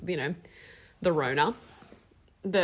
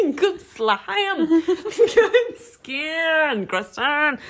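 laughing; 9 semitones higher, 6 dB louder, much slower than usual. Real, not acted. Laughing repeat.

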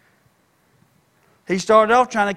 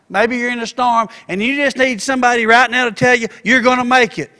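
rising, louder preacher's build; preaching at full force; 3 semitones higher, 5 dB louder than usual. Acted. Preacher rallying a struggling congregation, louder and higher.

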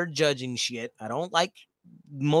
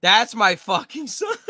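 usual speaking voice

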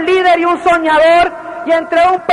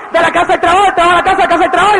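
normal talking voice

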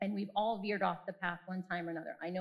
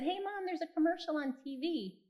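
higher, lightly voiced boy; a hint of an excited ten-year-old; lightly voiced son calling to his mom; son's voice; 8 semitones higher than usual. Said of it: "and he said".

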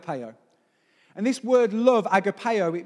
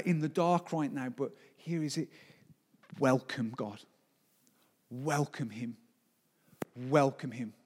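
much softer and lower, close to the listener; quiet pastoral appeal; 5 semitones lower, 6 dB quieter than usual. Acted. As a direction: invite them in gently. Quiet intimate closing appeal.

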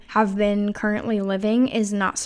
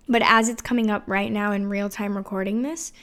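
ordinary talk

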